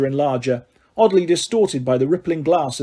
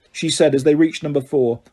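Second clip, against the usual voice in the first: plain reading voice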